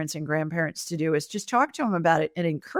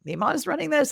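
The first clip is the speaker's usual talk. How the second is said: higher, disbelieving question; a pitched-up shocked double take; incredulous; 9 semitones higher than usual. Retold or imitated. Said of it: "I was like".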